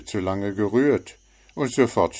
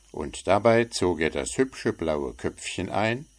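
ordinary delivery, nothing marked

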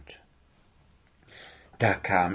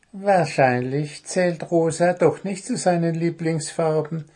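lightly voiced owl, pitched higher; higher-pitched storybook owl; little owl character; 8 semitones higher than usual. A narrator's reading. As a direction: offer it as a small owl's raised-voice guess.

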